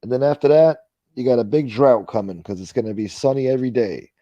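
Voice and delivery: loud newscaster weather read; weather report. Acted. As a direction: announce it like a TV weatherman.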